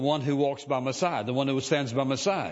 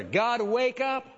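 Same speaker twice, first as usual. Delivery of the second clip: pleading call; 8 semitones higher, slower than usual. Pretend. Imagined desperate prayer.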